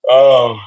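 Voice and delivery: loud open-mouthed yawn; big audible yawn. Real, not acted. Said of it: "excuse me, my bad".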